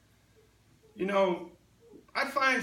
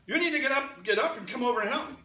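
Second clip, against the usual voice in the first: higher, louder call for help; bossy demand to a sister; 5 semitones higher, 6 dB louder, faster than usual. Retold or imitated. Preacher playing herself calling her sister, raised bossy demand. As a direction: order your sister to get up and help. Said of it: "I would have said to my sister".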